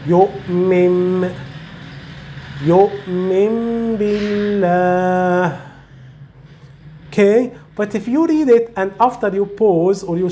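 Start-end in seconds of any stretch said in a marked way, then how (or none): 0.1-5.7 يؤمن بالله joined, noon turned to meem; iqlab recitation. Shown as a demonstration. Teacher shows reading both words together, meem sound. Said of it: "you will read it like here".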